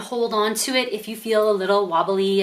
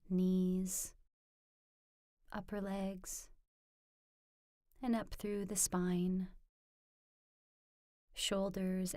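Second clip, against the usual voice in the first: much softer, slightly lower meditation voice; guided meditation; 4 semitones lower, 16 dB quieter than usual. Acted. Teacher leading viewers into a standing mindful movement practice, soft guided meditation. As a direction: guide them gently, hushed, with long pauses between phrases.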